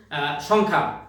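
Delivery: normal talking voice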